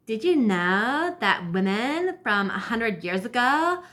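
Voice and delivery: put-on drawn-out, swooping Californian valley-girl voice; mock her slow valley-girl lilt. Vlogger quoting a woman's video, mocking valley-girl voice. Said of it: "She actually talks like that too".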